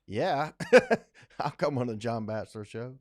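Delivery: chuckling through a happy acceptance; laughing yes. Retold or imitated. Telling viewers how he accepted the show: laughing yes. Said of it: "I was like".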